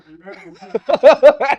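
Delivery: big high laugh bursting out; loud burst of laughter. Real, not acted. Telling friends the story, bursting into loud laughter. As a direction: crack up loudly at the memory.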